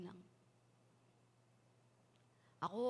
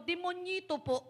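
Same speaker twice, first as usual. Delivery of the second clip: put-on child; 7 semitones higher, 4 dB louder, slower than usual. Child answers policeman.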